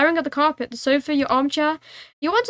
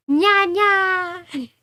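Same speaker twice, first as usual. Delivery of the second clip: playful nya; 4 semitones higher than usual. Pretend. Inner cat awakened.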